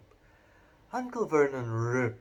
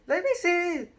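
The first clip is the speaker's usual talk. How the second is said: put-on very high demanding boy; 6 dB louder than usual. A narrator's reading. Dudley demands to see the letter, very high pitched. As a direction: demand it like a spoiled kid. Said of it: "demanded Dudley".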